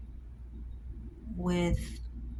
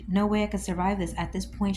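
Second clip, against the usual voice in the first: normal talking voice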